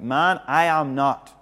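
slow, loud protest as Peter; Peter's loud denial. Acted. Peter's denial voiced in reading.

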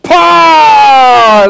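loud, high, long Irish "Power", falling; imitated Irish accent. Preacher showing the way the Irish say it, shouted accent. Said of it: "That's the way the Irish".

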